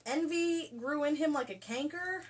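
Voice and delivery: normal talking voice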